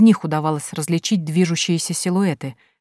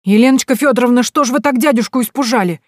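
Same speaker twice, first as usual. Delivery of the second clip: put-on high, loud boyish voice; a cheeky boy calling out; ringing boy's voice; 7 semitones higher, 6 dB louder than usual. A narrator's reading. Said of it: "послышался звонкий голос Прошки". (звонкий мальчишеский голос, громко и высоко)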